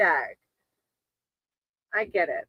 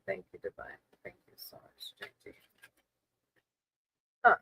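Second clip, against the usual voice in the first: hushed, murmured invocation to spirit; whispered prayer; 5 semitones lower, 11 dB quieter than usual. Real, not acted. Tarot reader asks Divine for guidance on JD's dream, whispered. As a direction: pray softly under the breath, asking for guidance. Calls on higher power.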